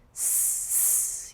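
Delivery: long hiss; snake hiss. Shown as a demonstration. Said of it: "We say that as a hiss".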